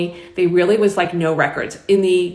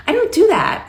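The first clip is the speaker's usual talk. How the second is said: quick refusal pitched way up; retold refusal in a much higher voice; high-pitched protest; 12 semitones higher, 3 dB louder than usual. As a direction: send the voice high on "I don't do that". Retold refusal, first take high.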